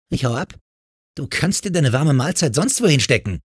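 snotty, high-pitched refusal; defiant rejection. Acted. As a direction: throw the offer back angrily. Girl angrily rejects the offer.

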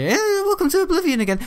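very high, silly voice; high put-on welcome. Gamer welcoming viewers to Oblivion again, high put-on voice. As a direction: squeal a mock greeting.

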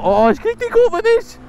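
sudden high panicked cry; alarmed. Real, not acted. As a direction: yelp as the bike slides. Bike nearly misses the curve.

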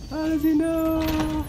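slow, high, stretched-out cry; drawn-out exasperated wail. Real, not acted. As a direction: wail it in disbelief. Found by the pursuer.

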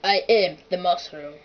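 low, firm action-hero robot voice; Terminator. Acted. Asked to sound like a film character, low Terminator voice. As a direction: drop it low like a movie cyborg. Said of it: "Sound like the Terminator".

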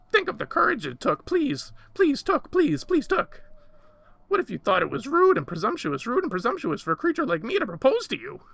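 high, loud creature voice; pleading little creature, frantic. A narrator's reading. Creature defends its proposal to madam, loud and imploring.

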